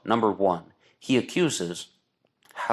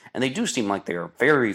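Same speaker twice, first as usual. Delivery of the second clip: normal talking voice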